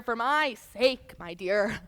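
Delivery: usual speaking voice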